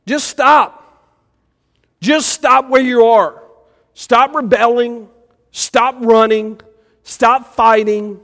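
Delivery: high, loud, insistent commands; firm voice of God calling a runaway to stop; voice of God pleading. Retold or imitated. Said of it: "God may be just sitting there going".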